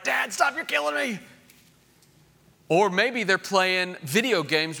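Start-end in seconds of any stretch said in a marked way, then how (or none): 0.0-1.2 high-pitched mock squeal of a tickled kid; tickled child. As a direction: squeal at dad as if being tickled. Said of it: "getting tickled and they're like".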